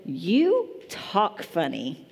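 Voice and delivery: put-on slow, drawn-out little kid quote; kid's words, slow. A little kid tells the preacher, slowly drawn out. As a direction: spell it out slowly like the little boy. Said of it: "He said".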